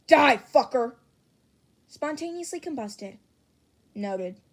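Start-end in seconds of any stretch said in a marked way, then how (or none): 0.1-0.9 angry yell. Acted. Bakugo yelling at a friend.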